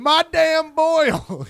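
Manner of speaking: put-on high, drawn-out yell of "My damn Boyle"; mangled Madame, hollered. Comic imitating mom confronting teacher, hollered "My damn Boyle". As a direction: bellow "My damn Boyle", DAMN replacing the French ending. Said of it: "she doesn't know that Madame is the prefix".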